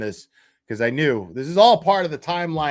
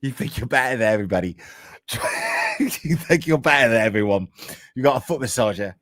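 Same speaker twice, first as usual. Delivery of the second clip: bad English accent; 4 semitones lower than usual. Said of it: "I just do a terrible fucking English accent".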